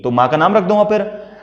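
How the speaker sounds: normal talking voice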